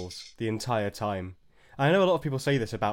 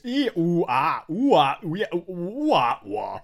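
loud, high run of mock Geordie vowel sounds; exploding Geordie vowels; 7 semitones higher, 6 dB louder, slower than usual. Retelling a pub Geordie's outburst, mock Geordie vowels, loud. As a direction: burst out in broad Geordie noises, louder and higher. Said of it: "this man would explode with just".

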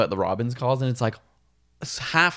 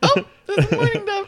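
laughing, much higher pitch; 4 dB louder than usual. Real, not acted. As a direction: giggle through the line. Joking about bird calls.

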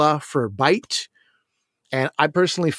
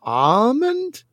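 incredulous questioning; 5 semitones higher, slower than usual. Retold or imitated. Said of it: "People would be like".

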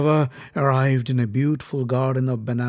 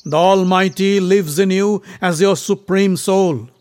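loud, raised, righteous declaration; declaiming prince; 4 semitones higher, 6 dB louder than usual. A narrator's reading. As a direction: proclaim it forcefully. Bhima declaims to the monkey.

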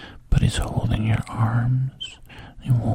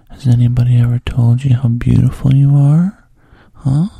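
voiced, louder coaxing lines breaking out of the whisper; coaxing man; 2 semitones higher, 9 dB louder, faster than usual. The man reassuring Bridget, louder coaxing voice. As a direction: lean in and reassure her out loud. Man's quoted praise.

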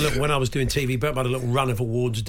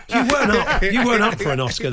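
laughing; 7 semitones higher, 3 dB louder than usual. Real, not acted. Teasing Terry.

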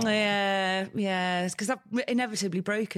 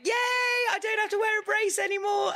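high-pitched mock celebration; gleeful cheer; 13 semitones higher than usual. Friend recalling life after the brace, gleeful cheer. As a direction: cheer it like sudden freedom. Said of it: "And then I was like".

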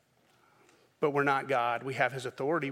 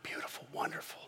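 mock whisper; 12 dB quieter than usual. Mocking eloquent prayer.

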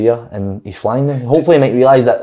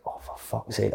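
very quiet retold curse; dismayed mutter; 18 dB quieter, slower than usual. Player on holiday reading text about new manager, dismayed mutter. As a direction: let it out under the breath, dismayed. Retelling dread at Lenny's appointment.

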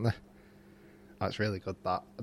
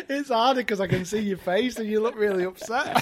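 high, softer voice through laughter; laughing; 7 semitones higher, 5 dB quieter than usual. Real, not acted. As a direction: talk while cracking up. Cracking up during the role play.

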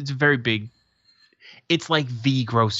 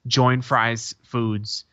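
sign read slowly; much slower than usual. Retold or imitated. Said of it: "One of them says".

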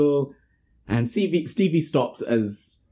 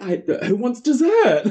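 overbright; 11 semitones higher, 3 dB louder than usual. Retold or imitated. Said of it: "and she's like".

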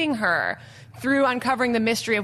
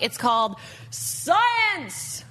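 big hall echo; 5 semitones higher, much slower than usual. Real, not acted. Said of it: "It's echoing in here, so it actually works really well".

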